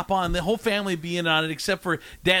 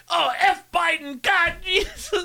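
higher, slow mock shout; hammed-up punchline yell; 11 semitones higher, 3 dB louder, slower than usual. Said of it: "they'll always say".

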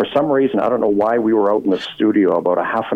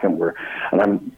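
usual speaking voice

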